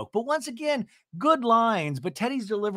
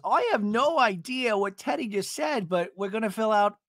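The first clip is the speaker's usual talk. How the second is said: imitating Gina, higher and ditzy; a lightly voiced confused Gina; mock Gina voice; 6 semitones higher than usual. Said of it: "Gina goes".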